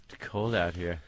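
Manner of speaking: low and soft, a lighter copy of the creep's murmur; a muted mock creep line. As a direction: repeat it low and hushed. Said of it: "echoing the creepy line".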